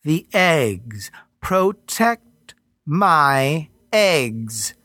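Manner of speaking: very slow, sweet goddess voice. Acted. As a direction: coax him slowly. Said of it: "Her voice was sweet again".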